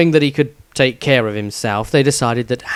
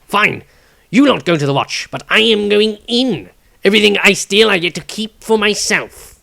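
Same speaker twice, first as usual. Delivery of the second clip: loud, higher, cocky thief; arrogant; 8 semitones higher, 5 dB louder than usual. Acted. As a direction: brush them off with arrogance. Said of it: "The halfling thief stated arrogantly".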